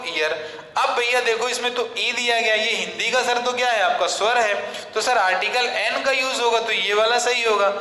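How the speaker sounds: ordinary talk, nothing marked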